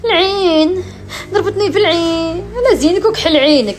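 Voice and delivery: high, loud, whiny complaint of the evil eye; hammed-up moaning influencer voice; mocking social media poster. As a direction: parody someone blaming the evil eye. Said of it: "يقول لهم".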